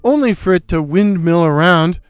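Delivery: ordinary delivery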